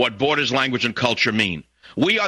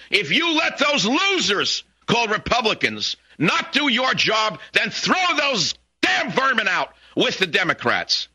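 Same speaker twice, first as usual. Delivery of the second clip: high-pitched, emphatic outburst; angry demand; 7 semitones higher than usual. Real, not acted. Radio host demands listeners throw politicians out, angry. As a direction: build to an indignant demand, pitched right up.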